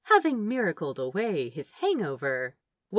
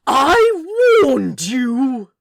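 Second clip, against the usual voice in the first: very slow, loud, high warning; menacing; 6 semitones higher, 12 dB louder, much slower than usual. Acted. The angel warns the cowering orangutan, slow and loud. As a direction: draw it out with divine menace.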